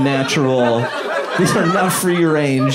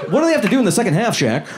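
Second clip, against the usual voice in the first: sideline reporter; 6 semitones higher, much faster than usual. Retold or imitated. Mock reporter asks Shaq question.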